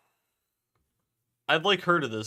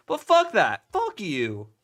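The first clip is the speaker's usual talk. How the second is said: sudden high angry yell; angry shout; 6 semitones higher, 7 dB louder, slower than usual. Real, not acted. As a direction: snap it out in a burst of anger. Heated rant at commenters.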